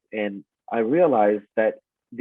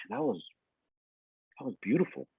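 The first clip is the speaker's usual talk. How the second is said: hushed, much softer voice with halting pauses; retold awe; 4 semitones higher, 11 dB quieter, faster than usual. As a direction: act out being quietly awed by a throw. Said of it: "instead of being mad, I was like".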